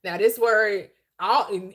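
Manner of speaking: usual speaking voice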